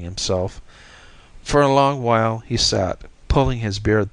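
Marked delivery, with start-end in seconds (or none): none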